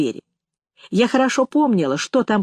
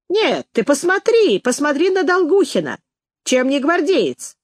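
lightly acted delighted boast; proud showing-off; 5 semitones higher than usual. A narrator's reading. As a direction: show him off with pride, pitch lifted. Headmistress shows off former pupil.

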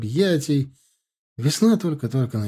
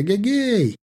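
calling out; 9 semitones higher than usual. Acted. Girl shouting echoing in hall.

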